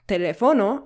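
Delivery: "teléfono" wrongly stressed as te-le-FO-no; misplaced stress. Shown as a demonstration. Teacher repeating the wrong example, stress shifted to FO. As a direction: punch FO instead of LÉ in "teléfono". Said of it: "it would sound wrong and strange".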